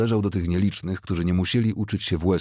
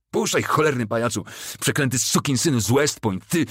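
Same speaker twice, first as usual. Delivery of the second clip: higher-pitched furious insults; heated, pitched-up outburst; angry cursing; 6 semitones higher than usual. A narrator's reading. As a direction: curse him while wrenching free. Struggling private curses his officer.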